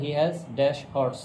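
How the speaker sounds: very slow, word-by-word reading; slow dictation. Shown as a demonstration. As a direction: read it out slowly for the class to follow. Teacher reads exercise sentence aloud. (ধীরে ধীরে বাক্য পড়া)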